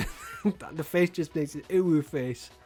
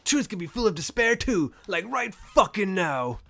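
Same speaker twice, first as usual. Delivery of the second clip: frustrated outburst; 5 dB louder than usual. Real, not acted. Player reacts to the twist.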